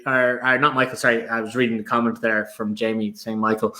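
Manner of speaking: normal talking voice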